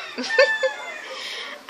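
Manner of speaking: very high laugh at the end. Real, not acted. Laughing after finishing story.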